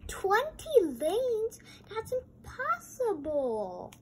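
high, loud, drawn-out disbelief; gasping twenty lanes in awe; amazed. Real, not acted. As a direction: stretch it out in wonder. Astonished reaction to story.

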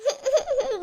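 a short, soft nervous giggle; nervous laugh. Real, not acted. Scared younger sister giggling at the warning, nervous laugh. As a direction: laugh off the fear.

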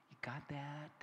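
a confiding whisper, voiceless; whispered aside. Real, not acted. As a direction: whisper it to the crowd. Preacher leans in to audience.

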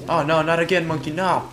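loud, high protest at the game; alarmed cry. Real, not acted. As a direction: cry out in dismay. Monkey in trouble again in game.

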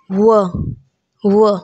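व as a plain WUH. Shown as a demonstration. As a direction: say व with no r at the end. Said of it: "आर भी नहीं लगेगा".

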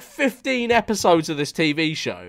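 ordinary talk

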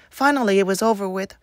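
ordinary delivery, nothing marked

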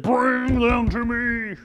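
a lightly voiced furious king; commanding king. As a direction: order it slowly like an angry king. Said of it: "in his rage and fury".

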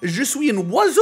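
goofy Québécois French line; mock Québécois French before the squeak. Silly French nonsense for caller.

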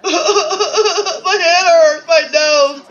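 high, slow comic blubbering; hammed-up wailing. Hurt character complaining of head and nose, high mock wail. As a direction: wail it out in exaggerated pain. Acted wailing after being hit.

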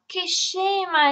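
high, slow cross cry at herself; annoyed exclamation. A narrator's reading. Anna scolds herself for forgetting grandma, high and cross. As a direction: scold yourself, drawn out and high. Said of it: "esclamò contrariata".

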